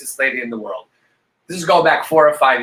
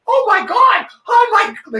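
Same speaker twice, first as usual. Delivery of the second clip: very high, louder shocked shriek; losing it; 5 dB louder, slower than usual. Retold or imitated. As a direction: squeal in panicked disbelief. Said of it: "like, losing it".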